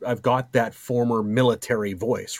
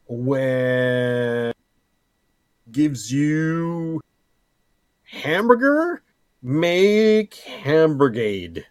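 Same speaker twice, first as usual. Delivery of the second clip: high, slow, silly announcing voice; drawn-out goofy proclamation; 4 semitones higher, 4 dB louder, much slower than usual. Pretend. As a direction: stretch it out like a mock proverb. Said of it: "Can you make a new one for me?".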